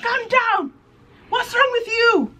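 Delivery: put-on a grandmother telling a panicking girl to calm down; grandma scolding. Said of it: "my grandma's like".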